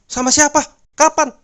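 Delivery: high, loud alarmed shout; angry shout. A narrator's reading. Husband shouts at his wife over the phone, angry. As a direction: demand answers, shouting. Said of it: "teriak suaminya marah".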